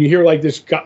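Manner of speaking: ordinary talk, nothing marked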